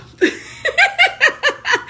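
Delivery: loud high burst of laughter; shrieking laugh. Real, not acted. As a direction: crack up hard. Cracking up at friend's joke.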